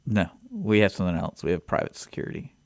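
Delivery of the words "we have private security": hushed, secretive reply; whispering. Real, not acted. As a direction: drop to a near whisper. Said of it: "Why are you whispering now?".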